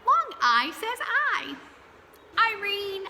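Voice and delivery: put-on pep it up for the kids; lively repeat. Shown as a demonstration. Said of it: "repeat, higher and louder".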